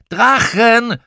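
high, loud, stretched yell; child's high-pitched holler; shouting back. A narrator's reading. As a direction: bellow the word over the noise. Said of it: "schrie ich zurück".

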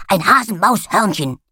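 loud, eager puppy on a scent; excited dog voice. A narrator's reading. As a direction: sniff out the trail, all excited. Dog character declares find.

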